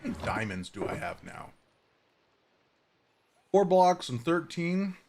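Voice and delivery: talking while chewing granola; eating while talking; crunching granola. Real, not acted. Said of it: "if you hear me crunching".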